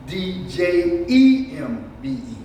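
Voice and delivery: slow, measured letter-by-letter spelling; spelled out. Shown as a demonstration. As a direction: spell it slowly, letter after letter. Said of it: "Sometimes it's spelled".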